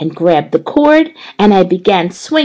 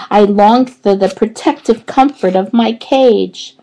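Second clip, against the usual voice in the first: ordinary delivery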